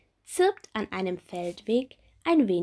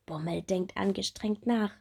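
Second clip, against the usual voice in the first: ordinary delivery, nothing marked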